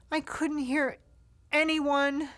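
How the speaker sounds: usual speaking voice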